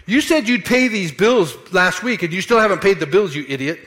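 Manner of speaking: hammered-up blame at the wife over bills; mock angry accusation. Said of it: "I could say it this way".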